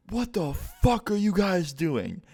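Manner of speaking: high, slow, mocking rage; imitating dad's angry yell. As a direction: mimic a furious dad bursting in. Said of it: "he starts screaming".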